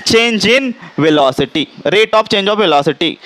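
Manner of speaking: slow, word by word for notes; slow dictation. Shown as a demonstration. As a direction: spell it out slowly so they can write. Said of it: "repeating definition for notes".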